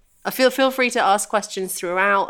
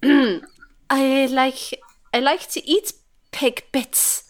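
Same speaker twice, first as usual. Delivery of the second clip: put-on Swedish accent; 4 semitones higher, slower than usual. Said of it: "you're doing the accent".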